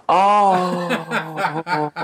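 high, deflated little oh with a laugh. Retold or imitated. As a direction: sink as the truth hits. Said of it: "I just deflated".

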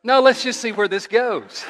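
a giggling aside full of mischief; playful laugh through the words. Retold or imitated. The mischievous angel's voice.

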